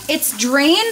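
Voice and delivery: usual speaking voice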